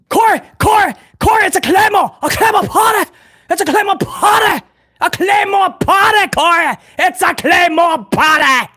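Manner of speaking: high, frantic gasping shouts; Kevin Patrick's breathless calls. Retold or imitated. Mocking the commentator's Sheamus call to Corey, frantic high breathless yelling. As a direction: gasp out every call like a commentator losing it. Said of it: "he sounded like he was out of breath because he would gasp things".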